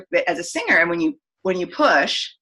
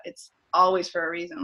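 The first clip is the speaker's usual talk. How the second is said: usual speaking voice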